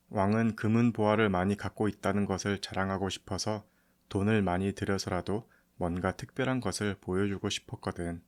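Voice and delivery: plain reading voice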